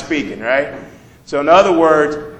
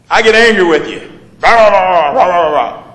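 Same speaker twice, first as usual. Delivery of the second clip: loud blah blah rant; mimicking an angry argument; mock angry rant; 3 semitones higher, 7 dB louder than usual. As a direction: rant back at him, louder. Acting out a quarrel.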